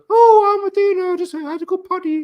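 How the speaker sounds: bad British accent as a goddess, high and silly; horrible British accent. Podcaster imitating Percy playing Athena, bad British accent. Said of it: "Percy was doing a horrible British accent".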